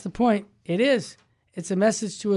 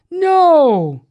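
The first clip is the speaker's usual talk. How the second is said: emphatic No; 8 semitones higher, 8 dB louder than usual. Real, not acted. Preacher rejecting compromise.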